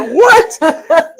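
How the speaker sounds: sharp high startled "What?" through a laugh. Retold or imitated. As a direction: burst out, caught off guard and laughing. Startled reply to wife's question.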